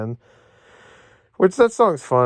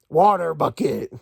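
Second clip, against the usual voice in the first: slow, drawn-out dopey movie line; slow mocking Steve impression; slower than usual. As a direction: ham up each item name like a dumb hero.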